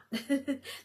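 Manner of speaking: brief burst of laughter; quick laugh. Real, not acted. Host stumbling over not being chosen, quick laugh. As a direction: laugh briefly mid-thought. Laughs at her own phrasing.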